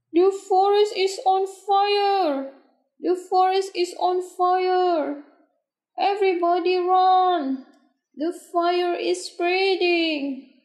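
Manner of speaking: high-pitched shouting, raising the alarm; shouted alarm. A narrator's reading. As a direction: cry out a warning to everyone in earshot. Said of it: "then Beaver started shouting".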